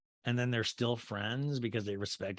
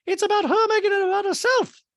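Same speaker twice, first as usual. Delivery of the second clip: very high, loud falsetto caricature; Dorit; 9 dB louder than usual. Retold or imitated. Recapper mimicking a castmate's accusation, loud falsetto. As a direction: blurt it in a piercing put-on voice. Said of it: "And Dorit goes".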